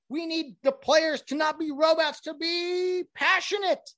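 high mocking voice of a media pundit. Sports pundit demanding celebrations, high sarcastic mockery. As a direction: parody the hype about passionate players.

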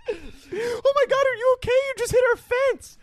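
put-on shocked girl. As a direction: play the startled girl. Voicing the fence owner girl.

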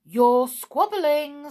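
loud, high, drawn-out accusation; cross and loud; angry. A narrator's reading.

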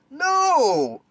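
sharp, loud and high no; emphatic no. Real, not acted. To podcast listeners about takeover crowds, a loud emphatic no. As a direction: slam the answer down hard.

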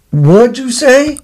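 high, drawn-out mock question; feigned not hearing. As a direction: goad him into repeating it, slow and high. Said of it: "I'm gonna make him say it again".

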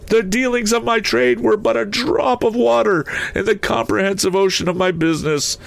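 very high, impassioned spectral voice; anguished ghost. A narrator's reading. Ghost rebuking himself before Scrooge, anguished high wail. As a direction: preach it high, wailing with remorse.

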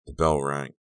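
plain reading voice

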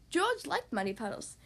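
ordinary delivery

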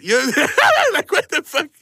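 laughing disbelief. Real, not acted. Mocking Connecticut truck sticker.

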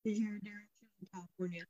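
muffled laptop mic sound; muffled and faint, like underwater. Real, not acted. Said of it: "you sound like you're underwater".

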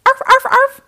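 high, loud yappy barks; puppy barking. Acted. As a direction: bark like an excited puppy. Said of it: "barked the puppy".

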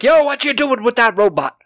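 high, raspy [name] voice; [name] impression. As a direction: shout it like the Godfather of Soul. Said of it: "he's like".

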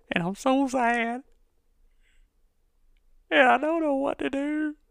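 high, whiny fake sadness; hammed-up boo-hoo voice; mock sad whine. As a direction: play it as a pitiful sob story. Mock lament about no sports.

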